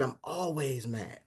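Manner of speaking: quiet slow menace. Retold or imitated. Hulk answering Captain America, slow and soft. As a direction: let it sit, low-key and dangerous. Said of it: "He said".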